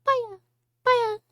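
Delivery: very high squeaky creature babble. Retold or imitated. Asking how the toy sounds, high Kirby voice. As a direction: pitch way up like a cartoon puffball. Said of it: "got, like, a higher pitched voice".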